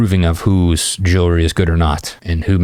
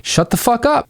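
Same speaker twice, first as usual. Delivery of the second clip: much higher, playful disbelief; mock disbelief. Coach shows the disbelief reward to students, mock amazed. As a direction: act amazed at her answer.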